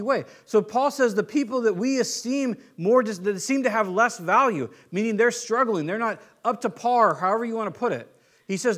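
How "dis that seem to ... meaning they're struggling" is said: ordinary talk, nothing marked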